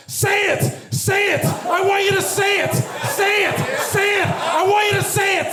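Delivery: high-pitched heckler's chant, yelled; mock yelling. Imitating a woman heckling from the audience, shrill insistent yell.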